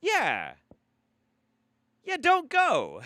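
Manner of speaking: high, loud mock warning; mock-incredulous advice. Host telling scam victims the obvious, high mock-incredulous. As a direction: exclaim it like blindingly obvious advice.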